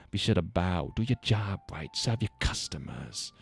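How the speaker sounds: put-on a light, undefined New York–New Jersey accent for a rude regular; gruff lounge regular in a loose New York accent, only lightly put on; vague New York accent. Pushy bar regular lectures the server, in a light New York accent. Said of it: "I don't know if I can voice him anymore".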